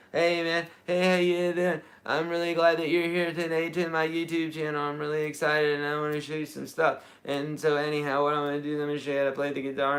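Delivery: mock bland YouTuber intro, rattled off fast; lightly voiced generic vlogger greeting; boring YouTuber. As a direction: parody a dull, rambling host who never gets to the point. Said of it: "that'd be like saying".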